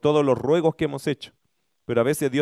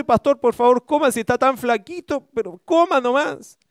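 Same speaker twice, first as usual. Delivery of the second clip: high, louder pushy hostess urging the pastor to eat; coaxing hostess; 9 semitones higher, 4 dB louder than usual. Retold or imitated. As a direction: coax him like a doting host pressing food.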